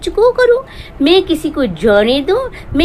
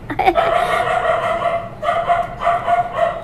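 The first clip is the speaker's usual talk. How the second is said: a long, high mocking cackle; high gleeful cackle; high mocking laugh; 5 dB quieter than usual. Real, not acted. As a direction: cackle at the rival's expense. Mocking laugh after a rant.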